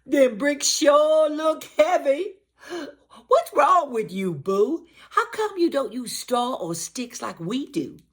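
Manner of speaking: high, loud mocking pig voices; squeaky teasing pig brothers; taunting pigs. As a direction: jeer at him like a cocky little pig. Two pigs taunting Boo.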